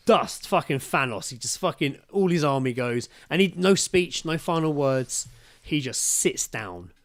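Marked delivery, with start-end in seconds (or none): none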